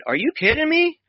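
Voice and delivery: sudden high-pitched disbelief; retelling his own amazement in a high squeak; astonished. Retold or imitated. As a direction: jump way up in pitch, stunned.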